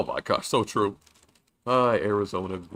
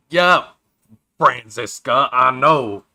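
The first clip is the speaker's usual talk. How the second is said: louder, curt and annoyed, the last words slow; irritated game character; 4 semitones higher, 9 dB louder, much slower than usual. Acted. Character answering Franziska, curt and annoyed, louder. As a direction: snap back at Franziska, then drag out the last line.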